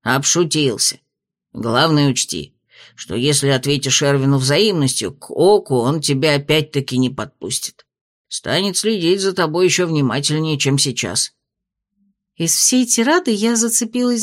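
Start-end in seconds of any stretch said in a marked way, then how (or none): 0.0-11.3 lower, measured spirit voice; low dragon spirit voice. A narrator's reading. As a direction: speak as a wise old dragon spirit. Spirit speaks.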